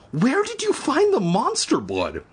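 high, urgent demand; shrill, insistent question. Acted. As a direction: press the dog for an answer. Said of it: "Evan demanded".

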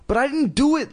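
high-pitched plea of innocence; crying out. A narrator's reading. Girl denying that she slapped her sister, crying out. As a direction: protest it way up high. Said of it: "Lindy cried".

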